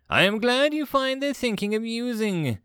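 high-pitched, irritated sarcasm; testy sarcasm. Acted. Commander rebukes admiring first officer, sarcastic.

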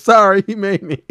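high, loud whimpering excuse; Bill's excuse. Retold or imitated. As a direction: squeal it guiltily. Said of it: "And Bill goes".

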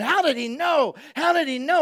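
high, loud astonished questioning; amazed disbelief. Retold or imitated. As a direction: marvel out loud at it. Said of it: "the disciples are going".

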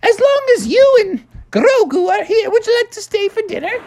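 very high, sweet falsetto mother; Mother Frog voice. Acted. Mother Frog inviting Mando to dinner, high falsetto.

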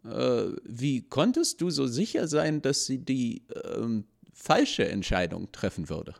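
high, searching voice of the sage; the sage asking. Acted. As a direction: ask it as the doubting sage. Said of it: "fragte der Weise".

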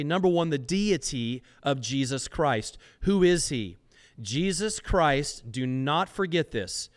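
normal talking voice

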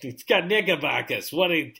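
put-on hammy New York accent; mock New Yorker telling off. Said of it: "our terrible New York accents".

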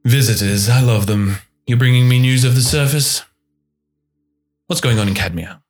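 a captain greeting visitors, loud and delighted; flamboyant captain. Acted. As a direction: relish the visitors' arrival. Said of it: "and he says".

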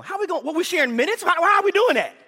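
ordinary delivery

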